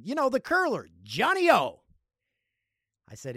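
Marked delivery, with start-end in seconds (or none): 0.0-1.8 high, loud mom voice; imitating mom. Mom explains the curler to son, imitated insistent voice. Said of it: "She said".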